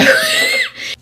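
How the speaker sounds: high disbelieving burst of laughter; a sudden high laugh at the absurdity. Real, not acted. Speaker bursts out laughing while retelling the confusing encounter. Incredulous laugh mid-story.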